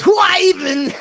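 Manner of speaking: high, loud nerdy squeal, laughing through it; John Frink impression. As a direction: squeak it out like the nerdy Simpsons scientist. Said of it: "That's the guy that goes".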